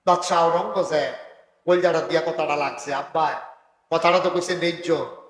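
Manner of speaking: normal talking voice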